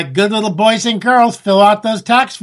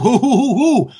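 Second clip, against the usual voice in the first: mock hooting; 4 semitones higher than usual. Mock outrage at Netanyahu.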